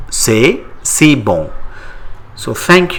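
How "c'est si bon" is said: slow repeat. Shown as a demonstration. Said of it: "How do you say it in French?".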